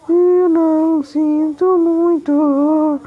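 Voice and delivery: very slow, weight on "eu"; slow defiant retort. Pretend. Player takes back his apology to the Curupira, very slow and defiant. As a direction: drag out "Eu não sinto muito" word by word.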